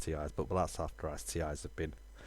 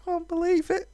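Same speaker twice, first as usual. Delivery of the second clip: high, drawn-out awed voice; high character voice; 12 dB louder, slower than usual. Acted. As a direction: read it as a wide-eyed fantasy hero, higher and louder. Voicing game dialogue.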